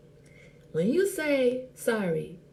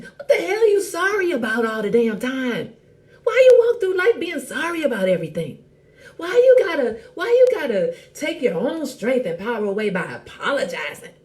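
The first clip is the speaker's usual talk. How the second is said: exasperated loud preaching; 5 semitones higher, 7 dB louder than usual. Real, not acted.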